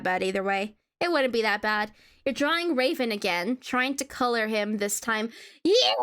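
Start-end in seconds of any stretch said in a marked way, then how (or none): 5.6-6.0 excited cheer. Real, not acted. Streamer cheering at chat's guess, then laughing. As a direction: burst out with delight.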